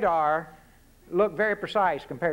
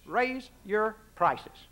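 curt presidential reply; 3 semitones higher, much slower than usual. Retold or imitated. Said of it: "What was the President's answer".

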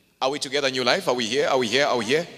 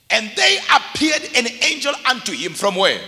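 loud, raised-pitch preacher's proclamation; full-force preaching; 3 semitones higher, 14 dB louder than usual. Real, not acted. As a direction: drive it home to the congregation, shouting.

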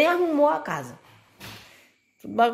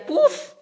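high mocking puff; 13 semitones higher than usual. Mocking his puf sound.

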